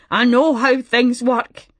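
slow, tearful insistence; sobbing caller. A narrator's reading. Distraught caller insisting to Martha, slow and tearful. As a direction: push the words out through sobs. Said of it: "between gasps and sobs".

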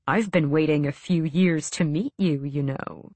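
low, cheerful menace, lightly voiced; a hint of a gloating villain; Farron, smug villain.

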